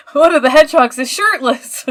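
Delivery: amused disbelief, laughing hard. Real, not acted. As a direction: blurt it through a laugh. Laughing at shirtless hedgehog.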